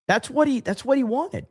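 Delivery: high, disbelieving; incredulous. Real, not acted. Streamer recalling a friend's wish to fight an alligator, incredulous and high.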